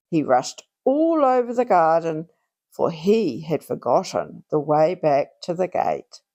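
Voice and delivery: usual speaking voice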